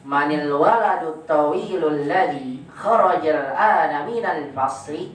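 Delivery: slow, each phrase lifted like a question, "fasli" rising at the end; question intonation. Shown as a demonstration. Teacher models question intonation for the pupil, slow and rising. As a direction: model the sentence slowly as a question. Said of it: "Diberikan intonasi pertanyaan".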